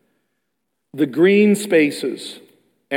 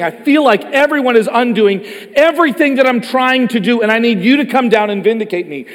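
pleading cry; 6 semitones higher, 5 dB louder than usual. Retold or imitated. David's pleading prayer, voiced by preacher.

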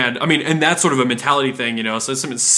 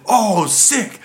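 excited customer; 8 semitones higher than usual. Retold or imitated.

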